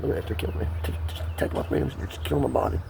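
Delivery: usual speaking voice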